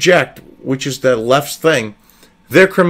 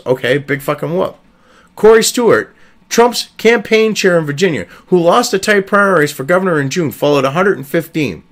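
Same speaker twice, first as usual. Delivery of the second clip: normal talking voice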